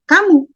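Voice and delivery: sharp rising jab on kamu; punchline stress. Acted. Punchline of the rhetorical contrast.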